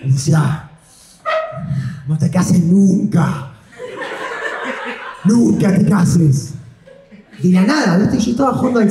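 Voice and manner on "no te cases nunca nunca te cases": slow, heavy, laughing warning; a hammed-up father's slow advice; solemn fatherly warning. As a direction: drag out the words like a weary dad. Father's warning voiced in story.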